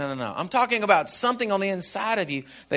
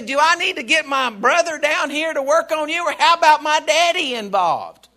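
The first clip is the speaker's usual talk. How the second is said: cocky threat; 8 semitones higher, 4 dB louder than usual. Acted. Believer threatening the devil.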